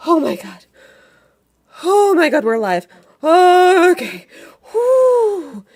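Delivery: high, loud gasp of relief; out of breath. Acted. Durdrin after fleeing the town walls, winded loud relief. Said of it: "out of breath".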